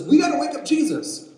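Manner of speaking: high, loud acted alarm; panicked urging. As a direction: cry out urgently to the crew. Panicked disciple urges the others.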